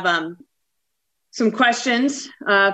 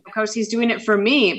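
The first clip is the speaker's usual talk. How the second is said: ordinary delivery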